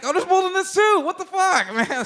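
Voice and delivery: high, loud exasperated cry; mock disgusted surprise. Shopper finding mold again, exasperated high cry. Finding more moldy berries.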